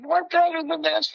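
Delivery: high-pitched mock scream; girl pleading with the crowd, high mock shriek; retold scream. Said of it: "some other girl starts screaming".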